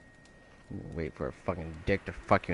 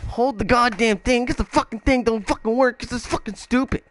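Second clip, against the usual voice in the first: real rage, yelling much higher and louder at the game; furious shout; 13 semitones higher, 8 dB louder than usual. Real, not acted. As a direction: scream your frustration at the controls. Raging at the fighting game.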